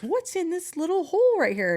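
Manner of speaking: high, curious voice of a movie character; curious horror-movie victim. Retold or imitated. A movie character asks about a hole, high and curious. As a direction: peer into the hole in a high, wondering voice. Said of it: "someone goes".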